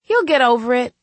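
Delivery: understated tearful reassurance; holding back tears. A narrator's reading. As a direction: say it high, fighting tears a little. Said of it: "trying not to cry".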